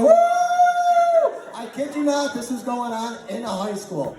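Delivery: ordinary delivery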